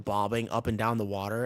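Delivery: usual speaking voice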